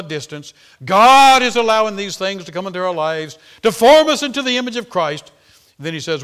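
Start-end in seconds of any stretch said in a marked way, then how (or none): 0.8-5.3 rising, louder sermon build; fiery preaching. Acted. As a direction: preach it higher and louder, drawing it out. Preaching at full force.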